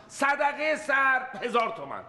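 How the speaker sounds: sums climbing to a furious high yell; building to a shout. Acted. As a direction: build the number up and explode. Escalating sums, ends shouting. (بالا بردن رقم تا فریاد)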